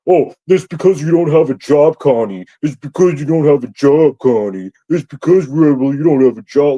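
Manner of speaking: loud, jeering impression of accusers; mocking accusers. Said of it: "you guys are gonna throw me under the bus".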